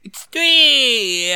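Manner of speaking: hammed-up nineties surfer shout, high; mock radical cheer; mock extreme hype.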